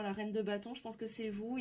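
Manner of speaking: ordinary talk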